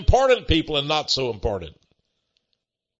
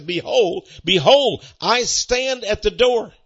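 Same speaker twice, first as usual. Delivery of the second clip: raised, slow proclamation; proclaiming; 6 semitones higher, 4 dB louder, slower than usual. Acted. Preacher voicing God, raised slow proclamation. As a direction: lift Behold up and out, then slow. God's words, high and loud.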